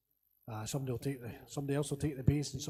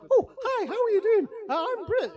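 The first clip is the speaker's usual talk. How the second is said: much higher imitation of a big man's squeaky speaking voice; surprisingly high voice; 6 dB louder than usual. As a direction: mimic a high little voice with a laugh at the end. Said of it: "is really quite high".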